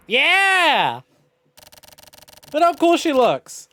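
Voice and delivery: high, loud cheer; high excited squeal. Real, not acted. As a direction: gush over the cool character. Excited about game character.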